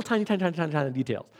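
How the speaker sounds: "tiny" rattled off very fast; rapid repetition. Real, not acted. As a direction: rush through the tinies. Piling up "tiny" playfully.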